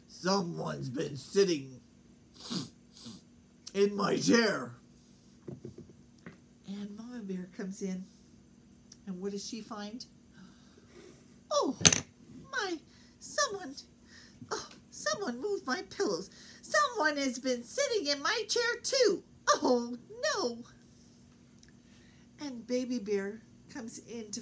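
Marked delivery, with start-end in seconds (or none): 0.1-4.8 slow, lower, louder deep bear voice; Papa Bear's voice. Acted. Papa Bear finding someone sat in his chair, slow deep voice. 11.5-20.6 much higher fussy mama bear; Mama Bear's voice. Acted. Mama Bear discovering her chair used, high fretful voice.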